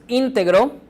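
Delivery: íntegro with ÍN given the weight; stress on first syllable. Shown as a demonstration. Teacher reading out the example, stressing the start of íntegro. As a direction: hit the opening syllable of íntegro. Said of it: "la lectura nos dará la pauta".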